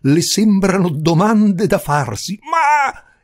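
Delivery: high, loud scolding; indignant shout. A narrator's reading. Countess scolding the visitor for his question, shouting. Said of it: "gridò".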